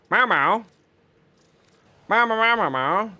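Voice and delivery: playful meowing, a little high; cat meows. Pretend. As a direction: call to the cats in a meow. Meowing at the cats.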